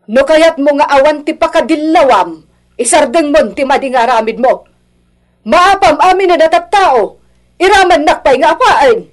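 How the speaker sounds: rising, loud angry warning; stern scolding. Acted. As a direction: scold back, building in force. Parent scolds child's spouse back.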